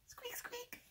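tiny, very high, soft squeaks; mouse squeak. Shown as a demonstration. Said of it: "squeak like a mouse".

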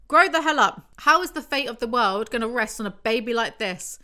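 fed-up scolding, loudest on the opening words; exasperated outburst. Real, not acted. Reader berating a crybaby character, exasperated outburst.

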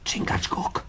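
a hushed, breathy call into the dark; whisper. Acted. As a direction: whisper the name so no one else hears. Night watch, hears quiet steps.

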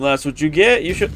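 normal talking voice

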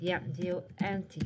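ordinary talk

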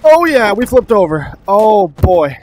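ordinary delivery

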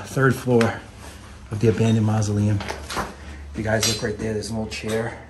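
normal talking voice

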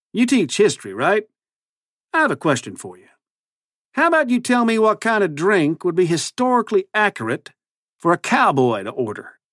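high-pitched folksy cowboy twang; cowboy accent. A narrator's reading. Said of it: "his ridiculous new accent".

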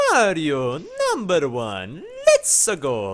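slow, swooping cartoon plumber voice; drawn-out, sing-song cartoon cheer; Mario. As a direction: stretch it out like a game mascot's catchphrase. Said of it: "I can do it all right".

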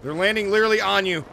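loud urgent callout; urgent shout. Real, not acted. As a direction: yell a warning to a teammate. Warning teammate of enemy landing.

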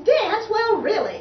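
high, loud mocking question; laughing scorn. Violins mocking Tubby's wish to dance, scornful. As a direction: hoot it back in disbelief. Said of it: "laughed the violins".

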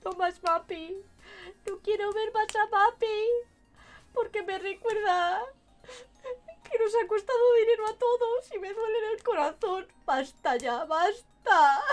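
loud, high pleading rant; exasperated pleading. Real, not acted. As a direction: beg them to make it stop. Begging to stop Mapi clips.

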